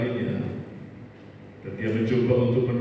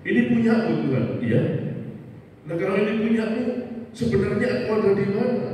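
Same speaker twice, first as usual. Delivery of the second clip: much higher, astonished and pleading; astonished question; 10 semitones higher than usual. Acted. Man realising footprints are God's, high astonished plea. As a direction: go up high in disbelief.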